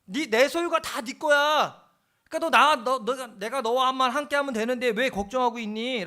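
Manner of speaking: loud, high, slowed-down pleading father; a father calling out to his elder son, insisting; father tells his elder son all he has is his, loudly and high. Acted. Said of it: "그 아버지가 큰아한테 얘기하는 거".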